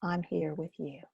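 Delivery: soft, gentle inner voice; kind reassurance. Shown as a demonstration. Teacher voicing what the hand says to scared places, softly. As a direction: comfort a scared part of yourself. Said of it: "you're saying".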